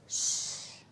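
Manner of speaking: voiceless "shh" to a class; long soft shushing sound; teacher's demonstrative shush; hushing shh. Shown as a demonstration. Teacher illustrating silent for children.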